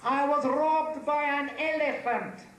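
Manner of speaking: high, slow, incredulous wail; exclaiming. Acted. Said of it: "he exclaimed".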